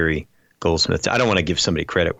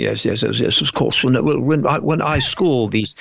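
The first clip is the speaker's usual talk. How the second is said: imitating a pompous British accent, higher; 5 semitones higher than usual. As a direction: lay on a thick posh British accent. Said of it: "a really pompous British guy with a really heavy, thick accent".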